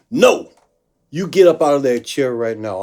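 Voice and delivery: loud, high mock yell at a seated man; shouted order. Drunk woman yells at the guitarist to stand up, loud and high. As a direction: bark the order from across the room. Drag queen yelling at him.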